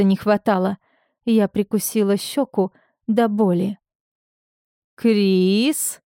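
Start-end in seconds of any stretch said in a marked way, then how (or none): 4.9-6.0 «Крис» stretched long with a rising end; drawn-out name. A narrator's reading. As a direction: draw out the vowel of «Крис», lifting it. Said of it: "протянул Тамир". (протяжное «Крис» с подъёмом)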